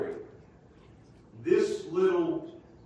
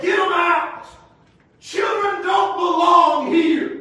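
loud, high and slow angry shout; disciples shouting; 9 semitones higher, 10 dB louder, slower than usual. Acted. Disciples shouting children out of church, loud and angry. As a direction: shout them out of the room. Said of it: "The disciples said".

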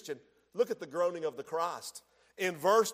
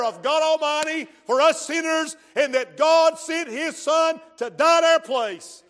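loud, higher shouted preaching; preaching at full force; 5 semitones higher, 10 dB louder than usual. Acted. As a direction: drive it home to every sinner. Preaching climax.

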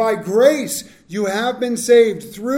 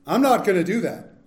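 ordinary talk

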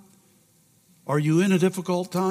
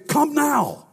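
urgent call; 8 semitones higher than usual. Acted. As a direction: call out like a summons. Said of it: "in the original text, it would say".

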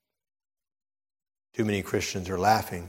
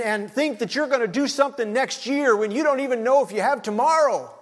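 much higher and louder, pleading and shouting; urgent preaching as God; 8 dB louder than usual. Acted. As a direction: shake them awake, as God calling. Preacher voicing God's urgent call.

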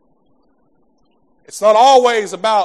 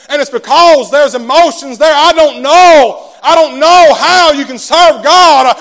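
higher, louder, slow shouting climax; full-force preaching; 7 semitones higher, 9 dB louder than usual. Real, not acted. Preacher rousing the church about joy, shouting.

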